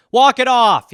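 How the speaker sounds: loud, high gruff order; coach's bark. Retold or imitated. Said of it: "That's what they always used to say".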